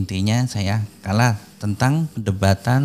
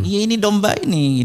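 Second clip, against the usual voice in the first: higher-pitched retold exclamation; mock eager claim; 9 semitones higher than usual. Menirukan ajakan saat debat. (seruan tiruan bernada tinggi)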